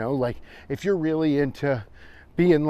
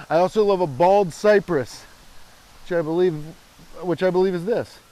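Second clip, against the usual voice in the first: mock bravado; 3 semitones higher, 5 dB louder than usual. Continues the Lil Ranny boast.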